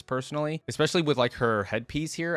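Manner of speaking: ordinary delivery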